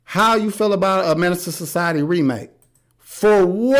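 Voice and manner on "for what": loud, high-pitched disbelieving question; baffled, scoffing outburst; incredulous. Real, not acted. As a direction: throw it back as a challenge.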